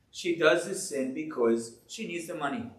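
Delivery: usual speaking voice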